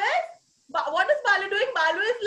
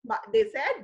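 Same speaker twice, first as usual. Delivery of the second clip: ordinary delivery, nothing marked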